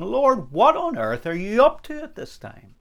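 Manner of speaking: high, exasperated question to God; acted cry of a puzzled believer; baffled plea. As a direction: plead upward in bewilderment.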